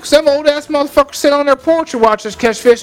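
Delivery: mock exasperated yell. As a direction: shoo him away, fed up. Retells telling off the neighbor.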